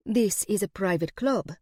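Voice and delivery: put-on light Eastern European accent from the waitress; waitress in a lightly voiced Eastern European accent. A narrator's reading. Waitress explains the club to a guest, Eastern European accent. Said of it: "she says in a thickly Eastern European accent".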